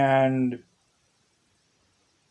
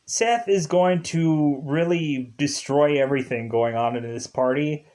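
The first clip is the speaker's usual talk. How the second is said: ordinary delivery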